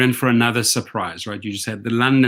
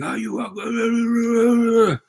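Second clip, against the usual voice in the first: mock outraged shout; 11 semitones higher, much slower than usual. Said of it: "hear this ring".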